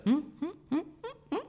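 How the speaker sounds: squeaky feigned-dumb hums; high-pitched nervous humming. Retold or imitated. Worker dodging the cop with high squeaky hums. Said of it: "And the guy's like".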